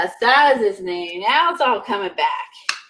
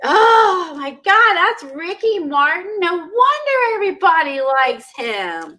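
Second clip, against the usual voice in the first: real amazement; 7 semitones higher than usual. Real, not acted. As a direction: start on a long, high "oh", thrilled by the discovery. Realizes actor was [name].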